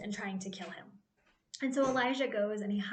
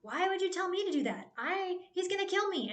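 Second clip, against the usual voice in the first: mock panic, pitched much higher; freaking out; 8 semitones higher than usual. Obadiah panicking at Elijah's order, high mock panic. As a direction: squeal it like a terrified servant. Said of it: "freaking out".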